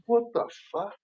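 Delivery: high-pitched disbelieving exclamation; shocked. Retold or imitated. Retold shock.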